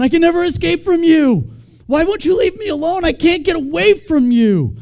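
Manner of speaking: high, loud, overwrought wail; smothered person. Acted. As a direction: read it like someone desperately trapped, loud and high. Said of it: "read it with the wrong inflection ... smothered person".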